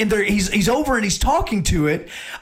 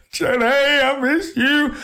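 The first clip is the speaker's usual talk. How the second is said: slow, higher, tearful mock cry; mock sobbing Forrest; 8 semitones higher than usual. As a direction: blubber the line like a crying fan. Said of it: "just horrible crying".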